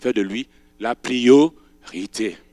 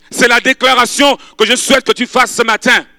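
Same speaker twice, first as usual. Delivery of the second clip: ordinary talk, nothing marked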